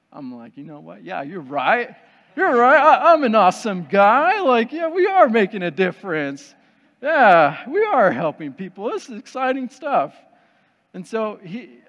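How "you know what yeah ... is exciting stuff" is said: hammed-up swell of self-belief, nearly shouting; perking up excitedly. Man pumped up by instructor's pep talk, mock-triumphant excitement. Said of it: "I perk up".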